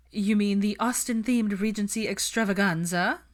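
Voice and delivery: hammed-up mock grandeur; mocking, dramatic. A narrator's reading. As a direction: tease it with theatrical flair. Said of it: "Hermione mocked in dramatic fashion".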